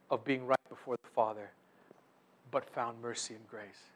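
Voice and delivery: ordinary delivery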